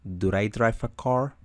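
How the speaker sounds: rising question tune on "car"; teacher showing a question's rising tune. Shown as a demonstration. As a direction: lift "car" at the end as a question. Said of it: "se le da esa entonación de pregunta".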